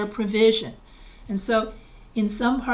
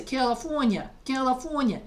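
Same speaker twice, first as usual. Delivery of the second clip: California in New York dialect, a little higher; New York accent; 3 semitones higher than usual. Shown as a demonstration. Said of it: "in my regional dialect".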